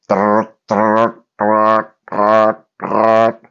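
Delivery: rolled-r door buzz; repeated trilled trrrt; imitated door rattle.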